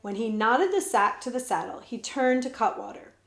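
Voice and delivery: plain reading voice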